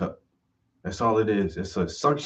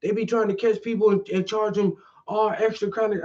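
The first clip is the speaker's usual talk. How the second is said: dog walker; 10 semitones higher than usual. Retold or imitated. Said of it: "He's like".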